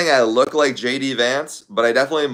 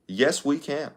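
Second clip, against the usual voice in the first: softer second try at the slogan; Obama impression; 4 semitones higher, 6 dB quieter than usual. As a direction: quieter Obama-style retake. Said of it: "the Obama impression is way easier".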